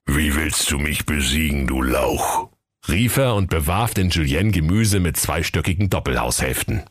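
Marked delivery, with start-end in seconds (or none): 0.1-2.5 low, rumbling giant voice; thunderous giant. Acted. Giant mocking the tiny prince, deep thundering voice. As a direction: rumble scornfully like a huge giant. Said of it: "lachte er mit donnergrollender Stimme".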